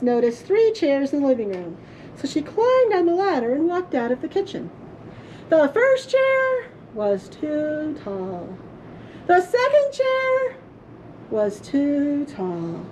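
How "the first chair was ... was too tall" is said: drawn-out, dragging each chair; slow comic build. A narrator's reading. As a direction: spell out the sameness, slowly.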